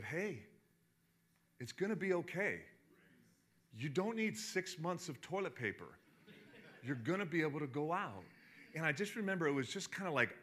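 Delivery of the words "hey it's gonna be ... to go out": soft, unhurried, reassuring like a doctor on TV; gently calm a worried nation; calm reassurance. Retold or imitated. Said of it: "very calmly".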